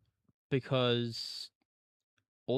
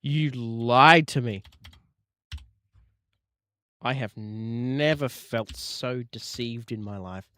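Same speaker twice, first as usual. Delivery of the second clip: mock betrayed; 9 dB louder, slower than usual.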